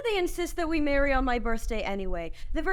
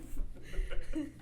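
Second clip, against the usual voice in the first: breathy laugh; 3 semitones higher, 6 dB quieter than usual. Real, not acted. [name] laughs at his joke.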